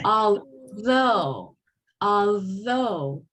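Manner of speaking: Although with buzzing TH; teacher models Although; voiced TH demo. Shown as a demonstration. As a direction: stress the TH in Although. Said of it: "forced by the next vowel".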